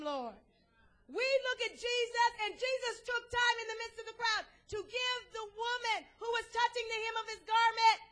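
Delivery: ordinary delivery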